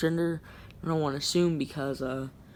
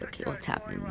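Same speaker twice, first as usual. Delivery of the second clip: breathy inner whisper, almost no voice; whispered thought; 8 dB quieter than usual. Retold or imitated. Narrator recalling a puzzled thought, whispered under breath. Wondering to self at the rink.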